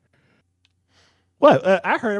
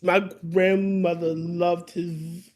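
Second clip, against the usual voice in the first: ordinary talk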